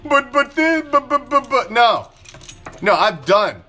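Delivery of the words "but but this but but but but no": high, louder mock stammer; sputtering excuse-maker. Vlogger mimicking angry fans stammering excuses, high sputtering voice. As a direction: stammer out the buts like a flustered fan. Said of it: "your stupid excuses".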